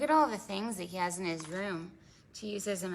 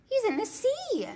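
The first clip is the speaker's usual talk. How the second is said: much higher excited exclamation; surprised; 4 dB louder than usual. Acted. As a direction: pop it up like a surprise. Surprise landing in sea.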